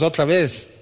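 ordinary talk, nothing marked

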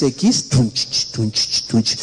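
beatbox the cumbia thump lightly; imitated beat.

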